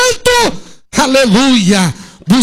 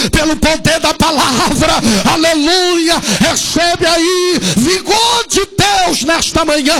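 fervent shout; 6 semitones higher than usual. Real, not acted. Pastor shouting a blessing of vigor over the church. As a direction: pour it out over the crowd.

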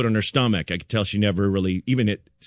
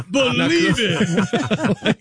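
high, stretched-out, mock-incredulous cry; drawn-out exclamation; 12 semitones higher, much slower than usual. Comic telling to the panel, high drawn-out exclamation. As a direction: draw it right out, pitched way up.

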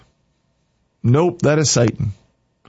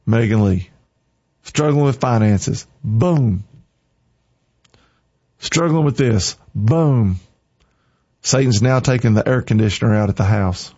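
ordinary delivery

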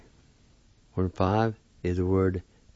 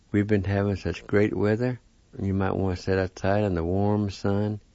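normal talking voice

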